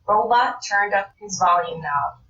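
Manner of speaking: ordinary delivery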